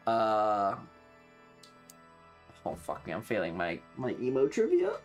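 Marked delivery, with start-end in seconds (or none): none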